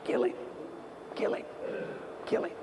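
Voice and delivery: soft, insistent "Kill him"; the crowd murmuring, urging. Retold or imitated. Preacher voicing the advisors, softly insistent. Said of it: "what was that voice saying".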